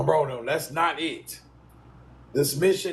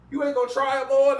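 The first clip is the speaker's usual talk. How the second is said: higher, faster mock voice of someone asking; a clerk puzzled he won't try them on, quick and higher; quoted shoe-store question; 7 semitones higher, 4 dB louder, faster than usual. Someone asks while he buys shoes.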